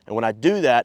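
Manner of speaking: ordinary talk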